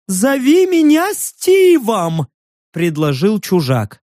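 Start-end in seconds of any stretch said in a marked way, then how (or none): 0.1-2.3 high, drawn-out eager cry; anxious stranger. A narrator's reading. Stranger hurriedly offers a name, anxious high voice. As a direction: pipe up high and slowly.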